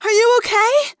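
put-on high, loud girl's shout; yelled. A narrator's reading. Girl checking on her friend, yelled. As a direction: yell the question, rising at the end. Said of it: "the girl yelled".

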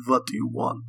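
put-on captive with a heavy Russian accent; thick Russian accent. A narrator's reading. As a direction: speak English like a Russian prisoner. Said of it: "his thick Russian accent coming through".

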